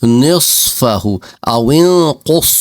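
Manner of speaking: "نصفہ" and "انقص" with a thick, full ص; thick emphatic s in "نصفہ" and "انقص"; heavy Arabic saad. Shown as a demonstration. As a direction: recite slowly, making the ص in "نصفہ" and "انقص" heavy. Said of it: "موٹا ہوتا ہے".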